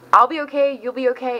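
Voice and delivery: usual speaking voice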